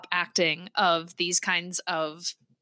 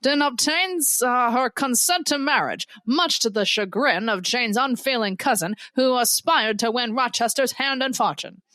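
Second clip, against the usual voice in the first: lively vintage newsreel announcer; old-timey newsreel delivery with swooping emphasis; old-timey newsman; 2 semitones higher than usual. Acted. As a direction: proclaim the synopsis like an old-time newsman. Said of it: "your old-timey newsman voice".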